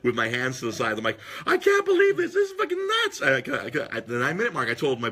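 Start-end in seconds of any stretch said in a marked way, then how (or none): 1.5-3.2 much higher, louder disbelieving outburst; disbelief. Retold or imitated. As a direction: squeal it like you can't believe your eyes. Retelling his reaction in the stands.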